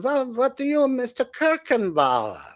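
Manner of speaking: put-on high, stiff German accent; German captain accent. German captain quizzing guests at his table, high stiff German accent. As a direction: play the German captain holding court. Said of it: "'Cause he was German".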